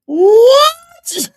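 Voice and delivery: high, loud, stunned yelp; shocked outburst. Real, not acted. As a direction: burst out in disbelief. Stunned reaction to the clip.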